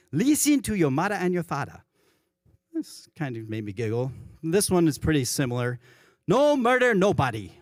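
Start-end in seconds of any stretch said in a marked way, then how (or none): 0.1-1.8 a light Hawaiian Pidgin lilt, LEESEN for listen; Hawaiian Pidgin quote. Retold or imitated. Pastor reading the commandment to the congregation, light Hawaiian Pidgin accent. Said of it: "listen is L E E S E N". 6.3-7.5 "No murder, nobody" in high, loud, playful Hawaiian Pidgin. Retold or imitated. Pastor reading the Pidgin commandment aloud, hammed-up Hawaiian Pidgin. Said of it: "That's how they talk".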